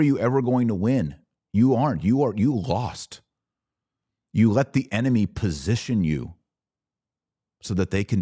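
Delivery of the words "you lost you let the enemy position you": normal talking voice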